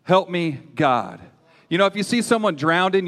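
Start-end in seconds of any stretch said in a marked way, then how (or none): none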